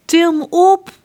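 high, loud command; calling out. Acted. Prince John ordering to be lifted up, loud. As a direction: call out bossily. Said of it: "roept prins John".

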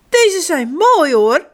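high, slow, emphatic protest; little boy standing up for his choice, loudly; indignant. A narrator's reading. Bart defends the sandals as mama laughs, indignant. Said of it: "zegt Bart verontwaardigd".

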